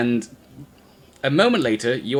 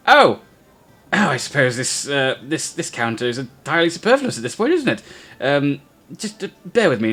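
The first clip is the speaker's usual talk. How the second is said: flustered halfling; 4 semitones higher than usual. Acted. Halfling peers over counter.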